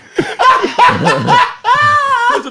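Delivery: loud high whooping laughter; real howling laugh; shouting laugh. Real, not acted. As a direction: howl with laughter. Still cracking up.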